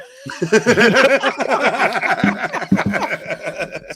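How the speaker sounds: loud, high burst of laughter; real laughter. Real, not acted. Laughs at own imitation of wife.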